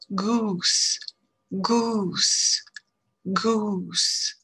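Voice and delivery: Goose with a long rounded oo; English long oo. Shown as a demonstration. Teacher drilling Goose for French learners with a long oo. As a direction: hold the oo of Goose. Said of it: "On y va".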